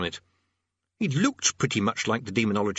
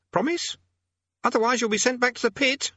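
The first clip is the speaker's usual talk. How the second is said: put-on high teenage boy voice; 3 dB louder than usual. A narrator's reading. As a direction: play a nervous young boy.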